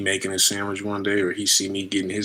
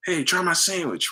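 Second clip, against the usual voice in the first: imitating offer; 9 semitones higher than usual. Someone offering a sandwich.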